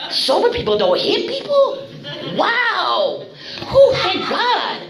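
high-pitched incredulous echo, played for laughs; mock amazement. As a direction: act astonished at unheard-of news. Acting amazed reaction to sponsor's rule.

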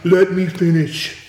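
slow, lightly imitated politician; Trump impression. Candidate insisting to an interrupting interviewer, slow politician impression. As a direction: draw out a mock candidate's demand for the floor. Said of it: "This is my Trump accent".

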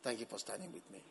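very soft, low, quick thanks; dying spouse. Acted. Dying wife thanking her husband, hushed and low. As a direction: barely voice a last grateful word. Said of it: "a spouse was dying".

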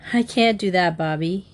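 usual speaking voice

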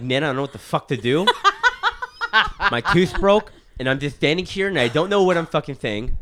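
loud lisped panic, laughing; lisping. Retold or imitated. Retold call to nana about the broken tooth, lisping panic. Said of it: "I'm, like, lisping because".

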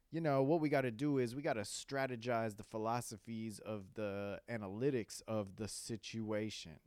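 loud, pompous big-word talk; puffed-up fake businessman voice; jail talk. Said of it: "Don't give me this jail talk".

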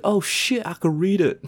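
high, excited realisation; surprised relief. Retold or imitated. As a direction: blurt it out amazed. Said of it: "it's just like".

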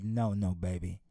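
low, calm and steady; father calmly reassuring his daughter in the storm. Acted. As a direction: reassure the frightened child. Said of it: "just as calm and said".

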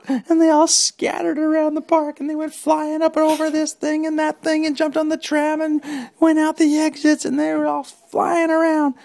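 high, loud breathless telling; excited narration. A narrator's reading. Kids scatter in panic, loud high excited narration. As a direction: rush it with excitement.

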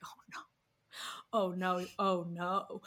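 soft, sinking imitation of alarm; hushed dawning dread. Friend looks up as the edible hits, hushed dread. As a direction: let it sink in under your breath.

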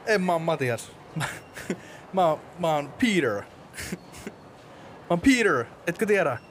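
high-pitched comic hero introduction; eager Spoderman. Acted. Plays Spoderman arriving at the interview.

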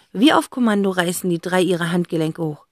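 ordinary delivery

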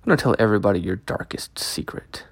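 low threatening whisper; menacing whisper. Acted. Ex threatens to reveal secret.

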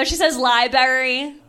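ordinary talk

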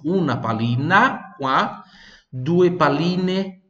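"pallina" ending in A, then "palline" ending in E, said slow and clear; stress on endings. Shown as a demonstration. Teacher contrasts singular and plural for students. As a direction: mark the A and E endings. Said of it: "com E".

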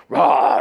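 sudden roar, imitating an angry biker; short, explosive mock roar; loud growled roar. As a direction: roar it out in one loud burst. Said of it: "and he goes".

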